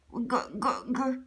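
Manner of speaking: quiet stuttered g sounds; feeble, choked growl attempts; strained failed growl. A narrator's reading. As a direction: try to growl and only sputter. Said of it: "I'm trying to talk".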